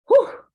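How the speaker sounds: high, loud whoosh of relief; relieved whew. Acted. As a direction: blow out an exaggerated sigh of escape. Leopard escapes, hugely relieved.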